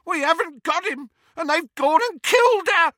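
very high, wailing fury; panicked shriek. A narrator's reading. Amycus panics over fallen sister.

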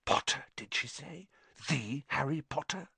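whispering crowd. A narrator's reading. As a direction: gossip under your breath across the hall. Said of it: "whispers suddenly broke out".

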